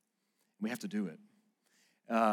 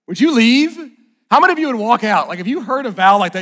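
much higher and louder, calling out to the room; loud challenge; 11 semitones higher, 17 dB louder than usual. Real, not acted. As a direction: pastor challenging the congregation, loudly. Pastor challenging congregation.

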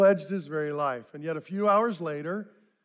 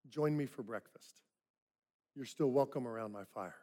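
tender welcome; 4 semitones lower, 10 dB quieter than usual. Acted. Jesus welcomes Peter back.

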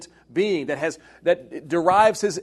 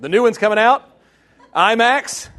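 high, loud fan enthusiasm; real fan excitement; excited; 7 semitones higher, 7 dB louder than usual. Real, not acted. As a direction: gush about it, pitched up and louder. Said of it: "So excited".

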